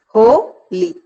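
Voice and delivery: "ho, li" split, with a loud ho; stress on first syllable. Shown as a demonstration. Teacher demonstrating a trochee, "ho, li" stressed on ho. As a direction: punch ho, then a lighter li. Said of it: "Ho is stressed and li is not".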